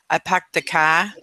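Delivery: imitating a Boston accent, dropped R; mock Boston accent on "car". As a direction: put on a playful Boston accent.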